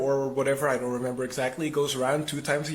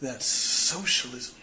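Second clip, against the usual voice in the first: slow, drawn-out disgust; much slower than usual. Retold or imitated. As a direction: stretch the words out in mock revulsion. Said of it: "the guide looks extremely disgusted".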